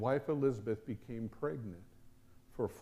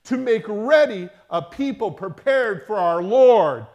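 rising from firm reading to full-force preaching, much louder and higher; building scripture reading; 3 semitones higher, 11 dB louder than usual. Acted. Preacher to congregation, building to a roar. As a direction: build the angel's promise to a thundering climax.

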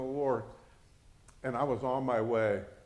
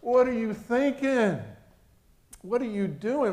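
retold outcry, pitched up and pushed; exasperated protest; 10 semitones higher, 5 dB louder than usual. Retelling a family argument, exasperated and loud.

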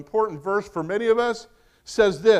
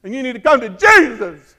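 loud, high hellfire preacher shout; mock revival preacher bellow; Southern Baptist preacher; 6 semitones higher, 13 dB louder than usual. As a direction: imitate a Baptist preacher yelling. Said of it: "and that's how he talked".

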